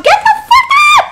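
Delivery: screaming at the character to move; frantic scream. Real, not acted. Panicked scream.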